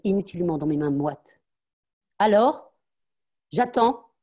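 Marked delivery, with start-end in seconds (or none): 2.2-4.1 loud, higher, clipped demand; impatient demand. Acted. Mother waiting for her daughter's thanks, loud and higher. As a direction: make her wait no longer.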